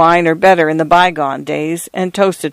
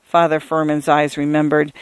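usual speaking voice

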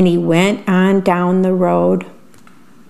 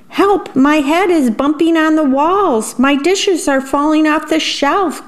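much higher, panicked worm voice; frantic cry for help; 7 semitones higher than usual. A narrator's reading. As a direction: yelp it out while tumbling. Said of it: "cried the worm".

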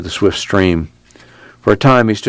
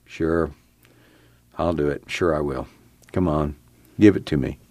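softer and lower, resigned; quiet giving in; 4 semitones lower, 7 dB quieter than usual. A narrator's reading. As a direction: murmur it, almost to himself. Giving in before voice rises.

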